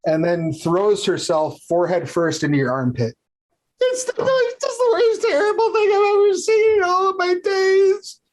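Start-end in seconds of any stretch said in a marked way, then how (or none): 3.8-8.2 very high hysterical wail; inconsolable car hop. Acted. Car hop throws herself into Alvin's armpit, hammed-up high wail. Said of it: "car hop is completely inconsolable".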